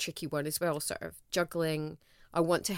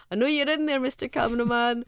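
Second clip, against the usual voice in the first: teasing the camera; 6 semitones higher, 5 dB louder than usual. Retold or imitated. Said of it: "I'd speak to the mirror".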